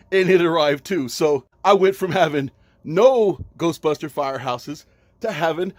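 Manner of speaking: loud, high, thrilled chatter; excited. Real, not acted. Toy dealer thrilled by his two firehouses, loud and high. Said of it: "So happy".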